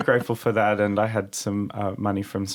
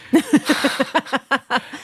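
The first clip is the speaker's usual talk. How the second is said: high burst of laughter; 3 dB louder than usual. Real, not acted. Laughing mid-story.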